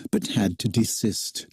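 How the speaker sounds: plain reading voice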